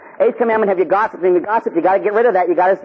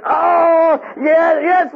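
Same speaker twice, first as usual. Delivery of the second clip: put-on frightened kid; 12 semitones higher, 4 dB louder, much slower than usual. Kid cowering before yelling priest.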